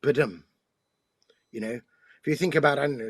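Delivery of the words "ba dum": ba-dum with a weak "ba" and a stressed "dum"; iamb rhythm. Shown as a demonstration. Poet showing the host the iamb's beat, stressing "dum". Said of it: "it goes unstressed, stressed".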